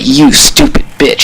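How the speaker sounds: high, slow, breathy sneer; imitating a contemptuous jab; mock insult. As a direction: drag out the insult slowly. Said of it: "he calls her".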